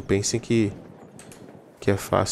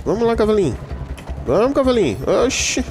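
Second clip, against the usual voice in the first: high, eager urging of a horse; coaxing a horse; 10 semitones higher than usual. Real, not acted. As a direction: coax the horse along, pitched up.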